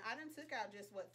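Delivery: ordinary talk, nothing marked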